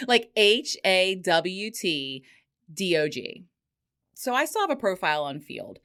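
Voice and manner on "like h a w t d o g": slow, letter by letter: H A W T D O G; spelled out letter by letter. Shown as a demonstration. Spelling the nickname out for listeners, slowly letter by letter. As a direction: spell the name out slowly, one letter at a time. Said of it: "that I'm gonna call Hot Dog".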